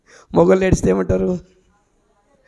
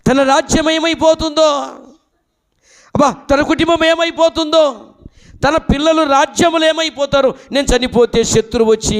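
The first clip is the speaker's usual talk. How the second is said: preaching lament; 8 semitones higher than usual. Acted. Preaching king's worries aloud.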